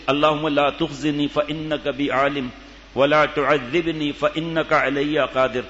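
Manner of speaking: measured, slow Arabic supplication; preacher reciting a dua slowly to the gathering; slow recited prayer. Acted. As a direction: recite it devoutly, word by word.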